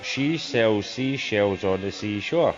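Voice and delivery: deliberate, unhurried recitation; slow careful tongue twister. Shown as a demonstration. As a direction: take each word of the tongue twister slowly.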